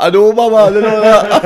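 high, loud retold outburst; loud, high-pitched self-quote; mock exasperated reply. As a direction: act out an over-the-top answer to your kid.

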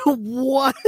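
high, loud incredulous "what"; baffled, amused. Real, not acted. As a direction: burst out in laughing disbelief. Baffled by sudden quacking.

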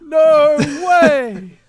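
excited. Real, not acted. Reacting to something in the game.

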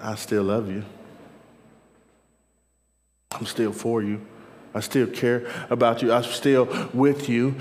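low, gentle reassurance; soft voice of the Lord. Acted. The Lord comforts someone who fell short yesterday, softly. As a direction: drop low and soothe tenderly. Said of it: "the soft voice of the Lord".

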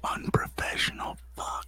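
hushed jab; whispered aside; whispering. Real, not acted. Whispered insults on stream.

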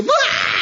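high startled yelp of surprise; surprised shriek. Retold or imitated. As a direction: squeal as if shocked. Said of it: "my wife's go, shout".

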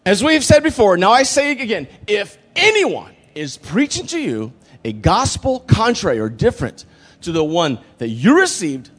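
high, loud, deliberate warning; emphatic stern Paul. Acted. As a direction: hammer each phrase of the repeated warning. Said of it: "He says".

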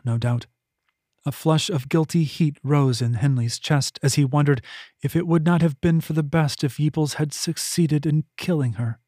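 usual speaking voice

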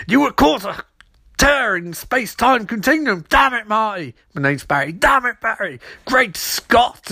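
high-pitched, exclaiming scientist parody; mock-agitated professor. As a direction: cry out like an excitable professor. Acting out an excitable Professor Stein.

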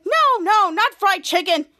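loud, high alarmed objection; high, urgent correction; urgent protest. Acted. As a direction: cry out to stop a mistake. Character stops computer's wrong call.

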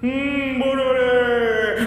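slow, sing-song phantom moan; ghost voice. Acted. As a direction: float the name like a spirit. Ghost voice again.

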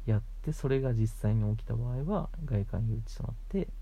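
ordinary delivery

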